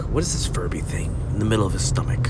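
fast hushed whisper; medical whisper. Pretend. Medical staff murmuring to each other, a quick whisper. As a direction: whisper it like a doctor conferring over a scan. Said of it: "that's how medical people talk".

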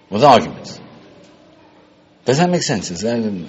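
ordinary talk, nothing marked

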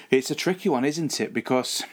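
ordinary delivery

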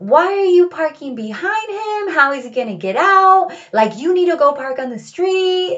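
high, loud scolding grandma voice; grandmother yelling. Retold or imitated. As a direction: scold him like a bossy grandmother. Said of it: "starts yelling at him".

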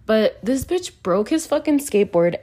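ordinary delivery

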